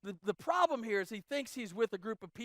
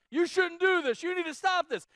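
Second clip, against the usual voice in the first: higher and louder mock scolding; mock yelling; 9 semitones higher, 7 dB louder than usual. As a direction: yell it like a bossy bystander. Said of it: "they aren't yelling".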